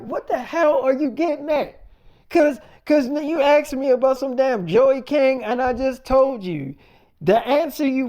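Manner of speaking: imitating his own irritated comeback; retold heated reply. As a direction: act out losing patience.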